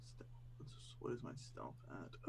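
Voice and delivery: whispered thinking aloud; whispering. Real, not acted. Player muttering to himself.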